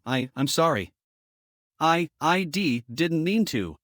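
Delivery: halting, stammering apology; awkward stutter. Acted. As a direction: stumble over the words nervously. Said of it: "I stuttered awkwardly".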